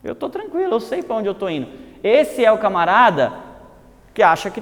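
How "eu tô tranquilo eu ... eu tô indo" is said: high, soft mock brush-off; smug dismissal. Man waves off the offered way, mock smug and high. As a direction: lightly play a man sure he needs no help. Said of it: "Ele fala".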